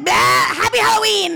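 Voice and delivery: high, loud Halloween yell; a playful spooky shout at the popping eyes; mock spooky shout. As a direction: pop it out like a jump scare. Corpse eyelids popping open.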